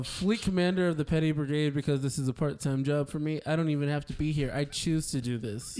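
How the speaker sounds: rushed intro. Acted. Quick title intro.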